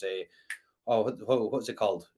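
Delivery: usual speaking voice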